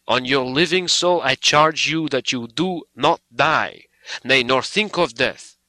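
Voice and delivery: rising to a fervent command; passionate charge. Acted. Van Helsing's passionate charge.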